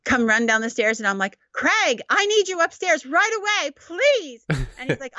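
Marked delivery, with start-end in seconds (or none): 1.5-4.4 high, overly sweet pretend summons; mock urgent call. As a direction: sound needy so he can escape. Staged rescue call.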